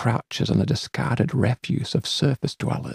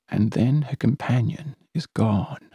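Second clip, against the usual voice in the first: ordinary delivery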